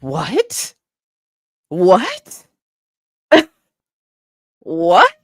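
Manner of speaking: rising, incredulous exclamations; shocked disbelief. Retold or imitated. Neighbor who couldn't believe the dog followed her, shocked. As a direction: repeat it with a sharp upward swoop, stunned. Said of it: "I was so shocked. I couldn't believe it".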